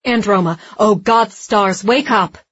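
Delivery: loud, urgent plea to wake; desperate pleading. A narrator's reading. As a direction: beg someone to come back to life. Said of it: "the voice was desperate".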